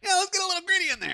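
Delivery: very high, fast put-on enthusiasm; mock eagerness. Host imitating their past love of gritty films, mock eager. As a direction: squeal it quickly like an eager fan.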